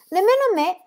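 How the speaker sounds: put-on high, small girl's voice agreeing; little girl echoing. A narrator's reading. Annika echoing her brother about fish, in a high little girl's voice. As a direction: echo him in a high childlike voice. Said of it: "fece eco Annika".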